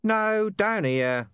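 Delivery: high, drawn-out, put-upon voice; weary sigh. A narrator's reading. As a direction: sigh it out slowly at someone missing you. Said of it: "the invisible speaker sighed".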